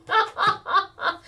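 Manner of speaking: squealing high laugh; high teary laugh; high giggle. Real, not acted. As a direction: burst into giggles at your own joke. Laughing at her own joke.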